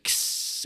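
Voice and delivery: breathy hiss, no voice; hi-hat hiss. Retold or imitated. Imitating Garrett's hi-hat, hissed. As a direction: make the hi-hat sound. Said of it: "Garrett goes like".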